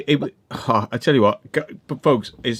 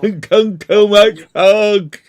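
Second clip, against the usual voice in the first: louder, higher, slow drawn-out words through laughter; laughing mock drawl; 5 semitones higher, 6 dB louder, much slower than usual. Real, not acted. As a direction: stretch it out teasingly while laughing. Mocking friend who drank too much.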